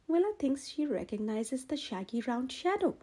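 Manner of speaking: ordinary delivery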